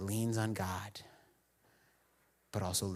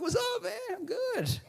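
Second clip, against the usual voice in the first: much higher breezy mock-cheery act; fake casual; 4 dB louder, slower than usual. Pastor back at work faking that everything is fine, high and breezy. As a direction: cover it up with a bright breezy front.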